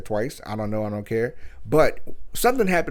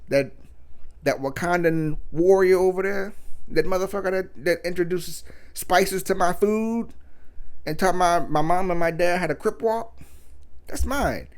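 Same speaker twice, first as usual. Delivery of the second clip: mock boastful boyfriend; 6 semitones higher than usual. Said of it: "Or you're like".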